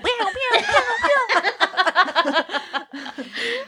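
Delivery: mock laser pew sounds.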